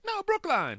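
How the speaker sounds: put-on very high, insistent old-lady correction; imitating exasperated woman. Woman correcting a mishearing, high exasperated imitation. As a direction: mimic an old lady correcting, pitch shooting way up. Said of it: "She'd be like".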